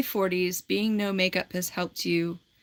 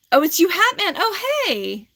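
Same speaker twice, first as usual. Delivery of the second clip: high, louder, delighted hello; mock surprised greeting; 10 semitones higher, 5 dB louder than usual. Pretending to greet Hat Man, high and delighted. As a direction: greet him like an old friend.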